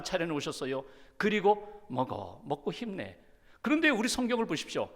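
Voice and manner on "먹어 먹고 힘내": lower, softer, caring words to a tired friend; tender encouragement. Acted. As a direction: coax him gently to eat. Jesus feeds Peter at breakfast.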